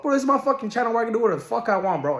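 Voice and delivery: fast, high-pitched defiant burst; venting. Real, not acted. Vlogger defending his channel to viewers, venting. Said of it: "I low-key vent".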